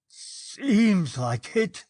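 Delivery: slow, halting, frightened stammer. A narrator's reading. Trembling policeman answers Miss James in a slow, shaken stammer. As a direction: force the words out through chattering teeth. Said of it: "he stammered".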